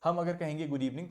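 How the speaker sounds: ordinary talk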